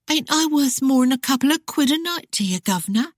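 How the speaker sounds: high, coy Cockney tease; taunting Cockney. Acted. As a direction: sell the mock doxy act to him. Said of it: "tauntingly rubbed her thigh".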